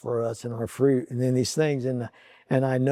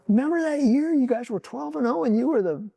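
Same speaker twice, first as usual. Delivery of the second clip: higher, urgent and insistent, reminding a son of his glory days; earnest pleading; 12 semitones higher, faster than usual. Retold or imitated. Father urging son CJ to remember his best season, urgent and high. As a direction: press it on him, eager and earnest.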